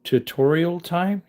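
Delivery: playful announcement. Acted. Announcing tutorial time playfully.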